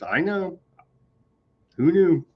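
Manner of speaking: high-pitched mock surprise; sarcastic disbelief. Real, not acted. Host reacting to forty-seven years of war claim, sarcastic.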